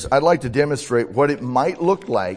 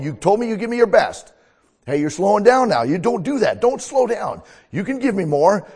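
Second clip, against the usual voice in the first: higher, building coach encouragement; encouraging coach; 6 semitones higher, 4 dB louder than usual. Acted. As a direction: cheer on a runner. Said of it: "I'm gonna be a coach".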